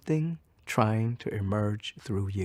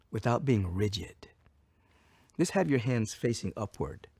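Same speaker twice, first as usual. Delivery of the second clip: ordinary delivery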